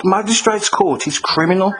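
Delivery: usual speaking voice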